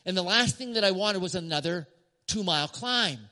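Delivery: normal talking voice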